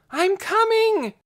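high loud slow yell. A narrator's reading. Lena yelling to Dune that she is coming. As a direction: stretch out a full-lung yell. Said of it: "she yelled at the top of her lungs".

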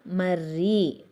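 మర్రి with a long, pressed double rr; stressed double r. Shown as a demonstration. Teacher to children, showing the pressed rr in మర్రి. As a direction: lean hard on the rr in మర్రి. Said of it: "ఒత్తి పలకాలి ఇది".

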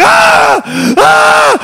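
very high, loud mock screams; imitated screaming. Comedian imitating his friend locked out on his shoulders, high screams. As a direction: shriek in short bursts. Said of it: "he does stop screaming".

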